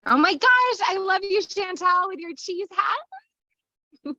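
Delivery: high, gushing delight, stretched at the end; real delighted squeal. Real, not acted. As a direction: squeal with affection at a friend. Delighted by Chantal's cheese hat.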